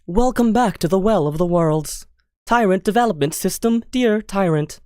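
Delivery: formal computer-system greeting; GLaDOS. Acted. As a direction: put on a video game AI announcer. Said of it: "Can I do glados?".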